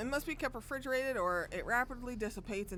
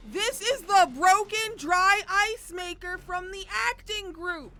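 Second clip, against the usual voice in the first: incredulous wail; 10 semitones higher, 11 dB louder, slower than usual. Real, not acted. Streamer realizing the twist.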